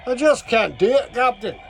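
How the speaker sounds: strained Scottish engineer pleading with his captain; a deliberately bad Scottish accent, played for laughs; poor Scottish accent. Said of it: "that's a real poor Scottish accent".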